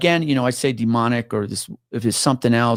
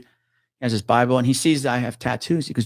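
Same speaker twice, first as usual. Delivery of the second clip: ordinary delivery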